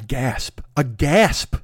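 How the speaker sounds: ordinary talk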